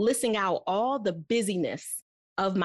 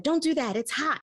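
parent scolding; 8 semitones higher than usual. Retold or imitated. Parent scolding child near stove.

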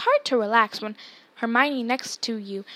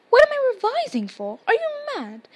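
high, louder disbelief; outraged disbelief; incredulous; 11 semitones higher, 7 dB louder than usual. Acted. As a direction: Hermione shocked at Ron's question. Hermione incredulous at Ron.

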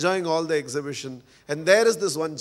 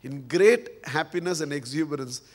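ordinary talk, nothing marked

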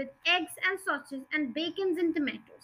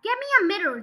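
higher-pitched demand; lightly yelled order; a touch of impatient shouting; mild yell; 6 semitones higher, faster than usual. A narrator's reading. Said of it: "the father yelled".

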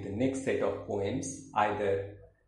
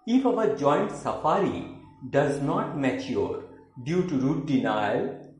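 declaimed love poem, opening high and emphatic; dramatic, deliberate verse reading; poetry recitation; 4 semitones higher, 6 dB louder, slower than usual. Acted. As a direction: recite with swelling stresses, settling slowly at the close. Recites second poem, Love Unconquered.

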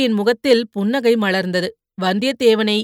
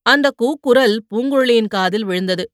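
plain reading voice

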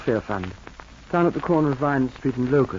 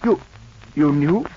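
ordinary delivery

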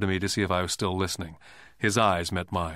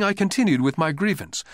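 plain reading voice

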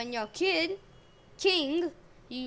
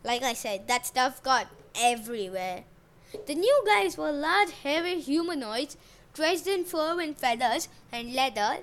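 ordinary delivery

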